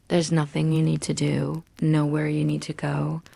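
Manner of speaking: plain reading voice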